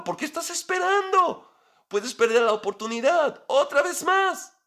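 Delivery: high, pressing exasperation at Esther; pleading Esther to speak up now; exasperated plea. Pretend. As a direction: urge her on, can't believe she waits. Preacher scolds Esther for waiting.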